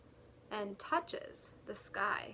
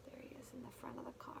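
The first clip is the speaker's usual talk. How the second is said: whisper; 16 dB quieter, much faster than usual. Acted.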